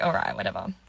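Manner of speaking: low, deadpan all right whatever; dismissive. Retold or imitated. To the winking date, low and dismissive. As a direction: drop it low and brush him off. Brushing off his reply.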